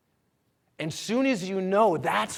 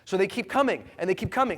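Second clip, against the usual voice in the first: normal talking voice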